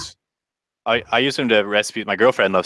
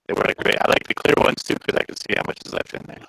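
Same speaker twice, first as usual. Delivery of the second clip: glitchy robotic call audio; laggy, robotic internet voice; half robot voice; 3 semitones higher than usual. Real, not acted. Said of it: "You went robot-y".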